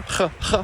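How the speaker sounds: ch as a raspy fricative at the back of the throat, unvoiced. Shown as a demonstration. Coach isolating the final Gaelic fricative. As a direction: breathe ch out at the back of the mouth. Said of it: "a fricative, uh, at the back there".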